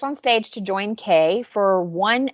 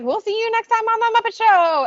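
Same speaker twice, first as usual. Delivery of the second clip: very high cartoon frog voice; imitated puppet host's excited sign-off; Kermit; 4 dB louder than usual. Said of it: "so Kermit's like".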